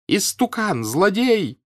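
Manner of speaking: high, furious shriek; spiteful scream. A narrator's reading. Wife turns on her husband, screaming spitefully. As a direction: lash out with venom in a high voice. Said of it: "злобно закричала женщина".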